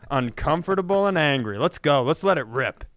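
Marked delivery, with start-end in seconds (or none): none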